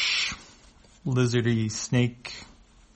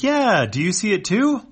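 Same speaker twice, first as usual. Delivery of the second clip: cheerful alien; 11 semitones higher, 6 dB louder than usual. Acted.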